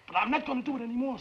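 high, fast, loud outburst; agitated refusal. Acted. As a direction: burst out that you've had enough. Refuses to keep rationalizing guilt.

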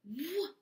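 a rushing whoa like a wave; whooshing whoa. Shown as a demonstration. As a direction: push out the whoa like a wave coming in. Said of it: "Again, W says".

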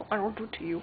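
breathy threatening whisper; creepy whisper. Pretend. Brother whispers a sneaky threat at his sister, creepy whisper.

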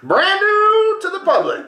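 high, drawn-out mock announcer voice; playful announcement. Podcaster heralding a never-heard story, playful announcement. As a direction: stretch it out in a squeaky mock fanfare.

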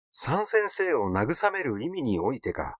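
ordinary delivery, nothing marked

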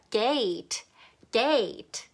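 "gate" with a big, clear A vowel; "gate" said as a careful model with full "ay"; full A sound. Shown as a demonstration. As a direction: open up the long A in "gate". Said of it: "A 소리를 크게 내주세요".

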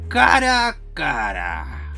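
slow, low menacing villain line; menacing gloat. Acted. Villain to Gregory, slow menacing gloat. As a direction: loom over him, savoring it.

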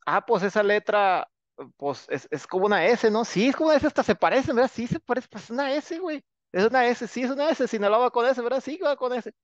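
high, hammed-up voice of naive folks deciding; mock the ignorant going high and silly; playful high-pitched impression of people long ago; mock dumb reasoning. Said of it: "Entonces dijimos".

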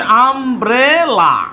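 Umbrella drawn out as আম, ব্রে, লা; slow syllable-by-syllable. Shown as a demonstration. Teacher dictating umbrella as the next example, slow syllables. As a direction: stretch each syllable of umbrella.

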